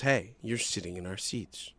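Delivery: low, soft, slow request; polite newcomer. Retold or imitated. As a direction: quietly point out the mistake. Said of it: "Someone else comes and goes".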